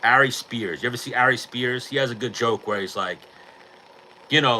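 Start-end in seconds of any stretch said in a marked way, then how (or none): none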